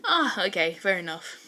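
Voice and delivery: ordinary delivery, nothing marked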